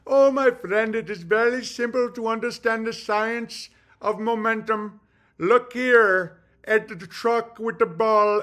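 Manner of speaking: high-pitched mock Indian accent. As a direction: imitate an explainer in an exaggerated Indian accent. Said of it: "it was an Indian saying it".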